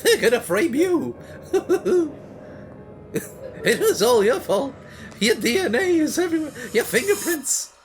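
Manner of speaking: high, laughing mock villain voice, slowing down; a giggling high creepy accuser; voice for the killer. Gamer voicing the silent clown, high and laughing. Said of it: "I'll do the voice for him".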